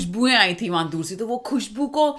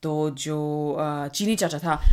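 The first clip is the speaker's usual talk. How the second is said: usual speaking voice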